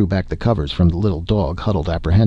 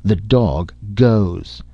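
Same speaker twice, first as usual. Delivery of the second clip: slow, deliberate counter-ruling; icy defiance, each word stretched; defiant; 2 semitones higher, much slower than usual. A narrator's reading. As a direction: contradict him with drawn-out finality. Wife defies husband, echoing his ruling.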